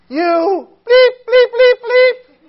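put-on high shrieking childish cursing; screamed curses. Kid screaming bad words at his brother. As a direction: shriek the bleeps at him.

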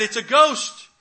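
high, loud frightened shout; retold panicked yell; mock scream. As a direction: cry it out like terrified fishermen. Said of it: "And they screamed".

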